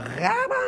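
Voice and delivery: piercing high yell as the blow lands; sudden high cry; high battle cry. Acted. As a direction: shriek out the strike. Sound of the head struck.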